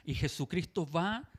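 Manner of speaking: usual speaking voice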